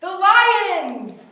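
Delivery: loud high announcement; excited reveal. A narrator's reading. Teacher reading to children, loudly revealing the lions. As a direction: boom the reveal out.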